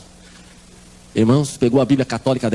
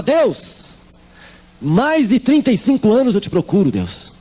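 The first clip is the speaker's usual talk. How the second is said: retold shouting at God; 6 semitones higher, much slower than usual. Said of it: "E ela gritava".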